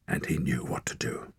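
whispered; hushed realization. A narrator's reading. Narrator whispers Torak's sudden insight. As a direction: breathe it as it dawns.

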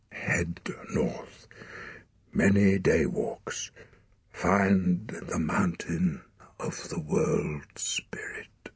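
slow, urgent whispered instructions; a weak father urging his son north; dying father whispering. Acted. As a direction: spend your last breath on it, slowly.

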